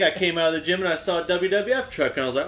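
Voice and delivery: ordinary talk, nothing marked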